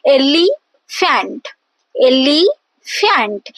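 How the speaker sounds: "elephant" slowly broken as EL-e, pause, fant; syllables separated. Shown as a demonstration. Teacher demonstrating a dactyl to students, slow separated syllables. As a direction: stretch "elephant" out, first syllable stressed, fant set apart. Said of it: "is kind of stressed".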